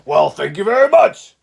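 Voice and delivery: big cheerful bear voice; grateful bear. Acted. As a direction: warm thanks from the sleepy bear.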